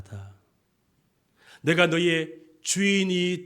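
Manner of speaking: ordinary talk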